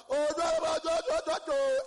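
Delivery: loud, high mock shout of no. A Nigerian kid entering school, shouting no. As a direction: yell the nos at full voice.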